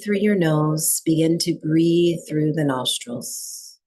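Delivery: calm, even meditation voice with long pauses; guided breathwork meditation. Real, not acted. Teacher settling a group into breathwork, calm meditation guiding. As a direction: guide them into stillness, leaving long silences between phrases.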